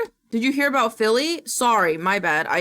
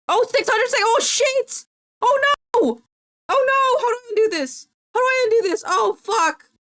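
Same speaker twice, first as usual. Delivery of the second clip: high, loud panicked yelping; frantic cries after a blunder; 14 semitones higher, 5 dB louder than usual. Real, not acted. Streamer who hit timeout by mistake, panicked. Accidentally timed out viewer, panicking.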